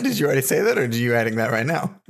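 usual speaking voice

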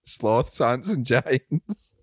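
very slow, words held in with rising ends; slow, careful, holding in a laugh. Shown as a demonstration. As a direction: drag each word out, fighting the giggles. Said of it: "try and say it without laughing".

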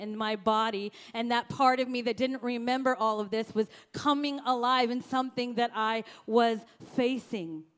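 ordinary talk

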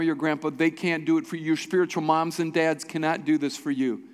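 normal talking voice

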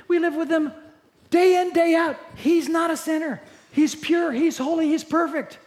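disciples gushing. Acted. Disciple insisting to the crowd, eager and loud. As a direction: gush about him like a devoted follower. Preacher voicing disciples' praise.

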